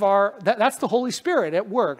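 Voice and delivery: ordinary talk, nothing marked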